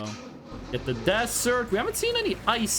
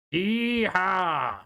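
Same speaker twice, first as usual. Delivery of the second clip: lazy cowboy whoop, voice put on; a mock Western yeehaw; 3 semitones lower than usual. Streamer playing cowboy for chat, mock cowboy call.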